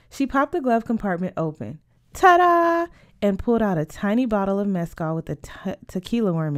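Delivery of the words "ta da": high, loud fanfare; triumphant reveal. Acted. Berta pulls out the hidden bottle, triumphant reveal. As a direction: sing out a magician's reveal.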